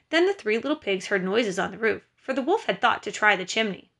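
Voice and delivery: usual speaking voice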